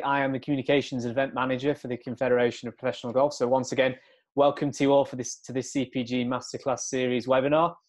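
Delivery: usual speaking voice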